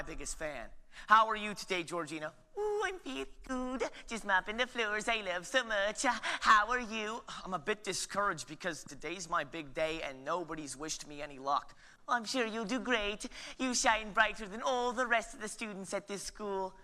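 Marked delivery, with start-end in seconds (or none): 2.6-7.2 higher, sweet Norwegian custodian; sing-song Norwegian lilt; Norwegian accent. Acted. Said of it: "She's from Norway". 12.1-16.7 gentle Norwegian encouragement; Norwegian accent. Acted. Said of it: "She's from Norway".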